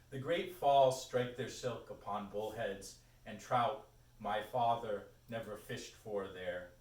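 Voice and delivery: slow, projected recital; poetry reading. Acted. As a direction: recite it slowly, weighting each image. Reads own poem aloud.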